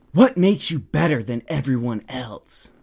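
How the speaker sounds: accusing stranger, imitated in an altered voice; mock indignant challenge. Stranger stops the speaker in the store, mock indignant. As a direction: imitate an affronted busybody confronting someone.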